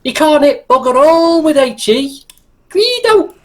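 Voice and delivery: high, exasperated Scouse commander voice; hammed-up Scouse moaning at the crew; Scouser playing tanks. Scouse commander correcting gunner Guido's shell choice, mock exasperation. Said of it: "I'll do the voiceover for it".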